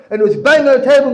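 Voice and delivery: loud, high, rapid shouted narration; carried away by the screaming; screaming retelling. Retold or imitated. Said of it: "he comes screaming".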